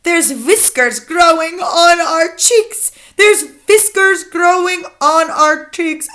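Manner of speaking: high, slow, loud chant; theatrical storybook outcry; shouted alarm. A narrator's reading. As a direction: cry out in dismay, stretching the words. Child's shouted complaint in witch's rhyme.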